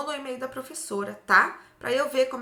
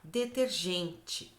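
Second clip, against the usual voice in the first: "Detergente" slow and softer; slow repeat; 3 semitones lower, 4 dB quieter, much slower than usual. Shown as a demonstration. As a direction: repeat "Detergente" gently and slowly. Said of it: "pra gente comparar".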